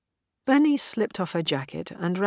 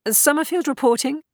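high, loud radio report; cadet reporting in; 11 semitones higher, 5 dB louder than usual. A narrator's reading. Cadet answering angry officer over comlink, dutiful report. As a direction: report loudly, pitched well up.

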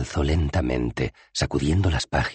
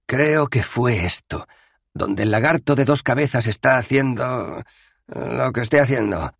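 Rincewind's voice; 10 semitones higher, 3 dB louder than usual. Acted. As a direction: feel your way through it. Wizard voice, slow.

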